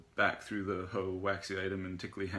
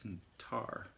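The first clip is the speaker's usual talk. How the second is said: softer, wrinkled-nose afterthought; 2 semitones higher, 6 dB quieter than usual. A narrator's reading. Zen naming another basement smell, wrinkled-nose voice. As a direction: add it quietly with a wrinkled nose. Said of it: "in his wrinkled nose voice".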